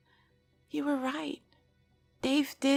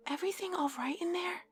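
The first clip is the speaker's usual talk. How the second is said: soft; 9 semitones higher, 5 dB quieter, faster than usual. Acted. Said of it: "Cass says softly".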